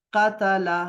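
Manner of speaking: क़तला with a on every letter, qa-ta-la; vowelled form. Shown as a demonstration. Teacher shows students the new vowelled form. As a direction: sound each syllable: क़-त-ला. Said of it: "अब ये formula है कि".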